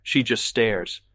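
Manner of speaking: ordinary delivery, nothing marked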